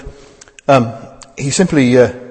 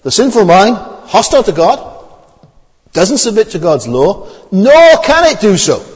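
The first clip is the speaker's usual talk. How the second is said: scripture reading; 7 semitones higher, 5 dB louder than usual. Shown as a demonstration. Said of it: "verse seven".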